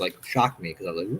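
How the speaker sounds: usual speaking voice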